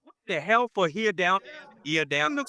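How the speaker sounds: put-on high old man scolding; high cranky grandpa. Imitating grandpa Dean.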